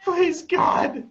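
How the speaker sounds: high, hammed-up cry to heaven; over-the-top imploring shout; mock desperate plea. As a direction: beg loudly in fake despair.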